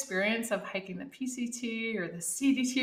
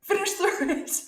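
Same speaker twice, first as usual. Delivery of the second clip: higher, louder, bossy teammate ordering her on; brisk teammate; 9 semitones higher, 3 dB louder than usual. Retold or imitated. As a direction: bark the order like a no-nonsense teammate. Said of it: "she was like".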